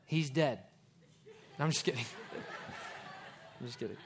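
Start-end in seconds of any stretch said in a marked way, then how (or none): 2.1-3.3 a breathy little laugh at own joke; laughing at own joke, soft and short; real soft laugh. Real, not acted. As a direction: chuckle quietly under the breath. Joke after the punchline.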